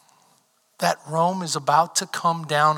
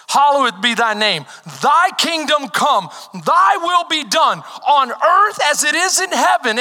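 prayer called out high and loud; shouted prayer; 10 semitones higher, 7 dB louder than usual. Acted. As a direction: proclaim the Lord's prayer at full voice.

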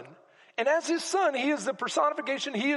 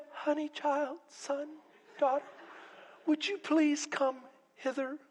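softly coaxing, oh-so-polite parent; mild, polite pleading; 3 semitones higher, 5 dB quieter than usual. Acted. Parent calling a child running toward the street, softly polite. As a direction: ask gently and sweetly, as if nothing is urgent. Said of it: "You're gonna yell at the top".

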